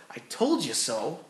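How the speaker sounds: an imagined gloat at the coworker, slow and low; smug told-you-so. Retold or imitated. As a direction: lean into a smug little dig. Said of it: "I could have very easily said".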